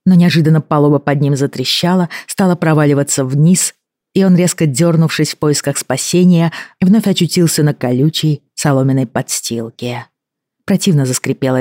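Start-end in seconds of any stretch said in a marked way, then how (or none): none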